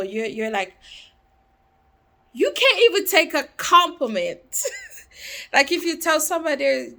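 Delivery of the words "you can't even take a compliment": laughing through the words, higher and louder. Real, not acted. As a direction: crack up mid-sentence in disbelief.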